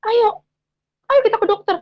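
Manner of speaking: high, loud urging; imitating husband pleading loudly. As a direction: push her to the doctor, as the husband.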